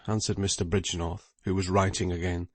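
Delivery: ordinary talk, nothing marked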